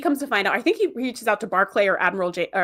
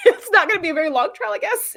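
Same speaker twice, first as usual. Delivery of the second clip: laughing; 9 semitones higher, 3 dB louder than usual. Real, not acted. Amused by thirty-three minutes.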